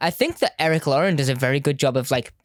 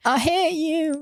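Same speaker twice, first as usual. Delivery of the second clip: mock hate; 12 semitones higher, much slower than usual.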